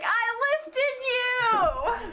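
high, slow, laughing outburst; exasperated cry. Retold or imitated. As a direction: stretch it out in disbelief. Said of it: "and you're like".

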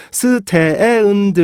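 스, 테, 에, 은, 드 with loose, open vowels; wrong, run-on syllable demo; broken-up syllables. Shown as a demonstration. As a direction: drag 스, 테, 에, 은, 드 out without stops. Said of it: "다 깨졌죠".

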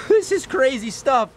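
high, slow, laughing; laughing excitedly. Real, not acted. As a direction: laugh through the amazement. Laughs amid the swarm.

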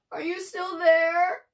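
drawn-out plaintive plea. A narrator's reading. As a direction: ask like you fear being left alone. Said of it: "the voice asked plaintively".

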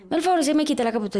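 high, fast mock nun's order; imitating a scolding nun. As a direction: snap it out like the strict nun, quick and high. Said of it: "Me gritó". (imitando a la monja regañona, aguda y rápida)

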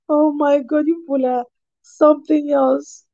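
high voice just after a laugh; amused, laughing. Real, not acted. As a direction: still giggling at them. Laughing at viewers' comments.

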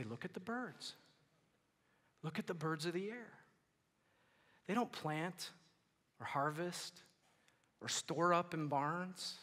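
soft, spaced-out gentle repeat; quiet, unhurried reassurance after an outburst; patient response. Acted. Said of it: "Jesus will listen".

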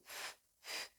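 f, f a bare hiss of air through lips and teeth; voiceless f. Shown as a demonstration. Coach isolating the f sound. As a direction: breathe out f, f with no voice. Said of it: "flu, f".

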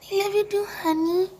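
delighted cooing at a cat; pet talk. Real, not acted. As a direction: gush at the cat in a high voice.

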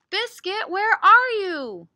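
loud, high, drawn-out call; big storybook holler. Acted. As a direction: call far off for a lost puppy. Calls for lost puppy in story.

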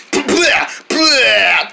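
drawn-out spluttering blah; pretend spitting out. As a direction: noisily clear the mouth. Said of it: "Is that out of my mouth yet?".

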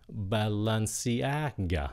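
"Balenciaga" slow, rising on "a" then dropping on "ga". Shown as a demonstration. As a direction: lift the AH in "Balenciaga", fall on ga. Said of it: "các bạn nhớ lên giọng nha".

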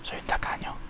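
hushed confession aside; whisper. Real, not acted. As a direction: whisper it to yourself.

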